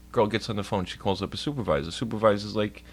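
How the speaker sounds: ordinary talk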